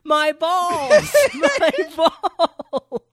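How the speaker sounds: high, drawn-out mock scream, laughing; loud, high-pitched imitation of a wrestler's howl; Bully Ray screaming. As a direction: scream it in comic agony, stretching the last word. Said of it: "Bully Ray starts screaming".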